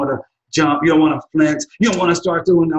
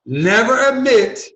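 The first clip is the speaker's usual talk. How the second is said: "Never admit" higher, louder and slow as a call; stress on NEVER ADMIT; 9 semitones higher, 4 dB louder, slower than usual. Shown as a demonstration. Lawyer leading viewers to chant along, loud and slow. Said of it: "say it with me".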